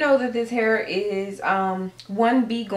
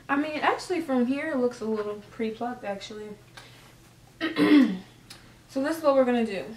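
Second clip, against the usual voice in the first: usual speaking voice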